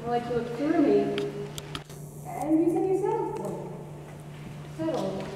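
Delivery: hurt, quiet. Acted. Hurt wife recalls his words.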